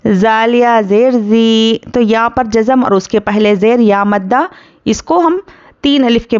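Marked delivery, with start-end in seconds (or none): 0.1-1.8 slow chanted tilawat; Quran recitation. Shown as a demonstration. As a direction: recite slowly with long vowels. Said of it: "teacher recites". (धीमी तिलावत)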